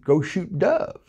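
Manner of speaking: usual speaking voice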